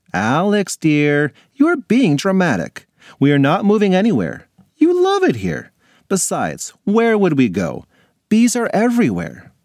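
put-on a mother gently calming her child; mom voice. Mom calmly dismisses Alex.

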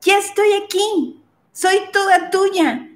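entrega ilusionada, bastante más aguda; anúnciale que ya llegó, con ilusión y voz alta de tono; chica ilusionada. Acted. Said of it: "le dice al hombre". (la chica le dice al hombre que es toda suya, aguda e ilusionada)

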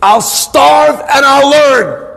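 high, slow, drawn-out shouted vow; defiant shout. Retold or imitated. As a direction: cry it out, stretching the key words in defiance. Husband's defiant vow against Satan.

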